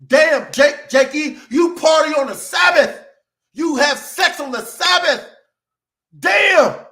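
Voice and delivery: high, loud mocking yell at Jake; mock shout. Preacher mocking backslider Jake for partying on the Sabbath, mock shout. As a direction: call him out like a scandalized neighbor.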